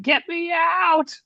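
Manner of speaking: high, drawn-out mock yell. Player playing someone trapped in the pit, yelling.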